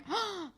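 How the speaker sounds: high theatrical gasp; a big fake shocked gasp; mock gasp. Friends react to the robbery, high mock gasp. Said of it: "we're all like".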